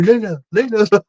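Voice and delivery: a hint of excitable monkey; little monkey impression. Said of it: "running over like a little monkey".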